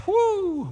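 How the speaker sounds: high, sustained "whoo"; overcome whoo. Real, not acted. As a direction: let out a long high whoo of overwhelm. Overcome while recalling God's words.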